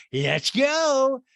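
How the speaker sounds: high, loud drawn-out cheer; mock excited. Podcaster imitating a keen skier, mock excited cheer. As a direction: whoop it as a put-on rally cry.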